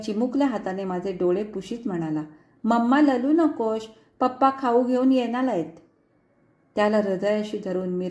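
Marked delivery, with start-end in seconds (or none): 2.6-5.7 put-on lisping toddler comforting. Toddler comforting crying mother.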